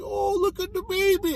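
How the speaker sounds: high, soft cooing voice; mock baby cooing. Fisherman holding the baby last, imitated cooing. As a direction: put on a high coo over a newborn.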